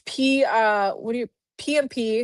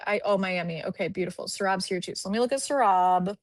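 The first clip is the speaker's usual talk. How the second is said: ordinary delivery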